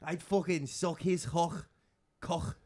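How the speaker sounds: a gushing Liverpool fan, hammed up; scouse accent. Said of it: "Paddy Pimbleton's region?".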